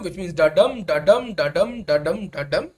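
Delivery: "da dum" with the beat on dum each time; iambic rhythm demo. Shown as a demonstration. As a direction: tap out "da dum" like an iamb, stressing dum. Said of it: "if something is written in iambic rhythm".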